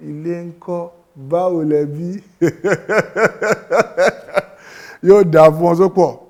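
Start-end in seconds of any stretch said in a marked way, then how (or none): 2.3-5.0 long, loud rippling laugh; delighted laugh. Real, not acted. Teacher pleased with pupil, laughing loudly.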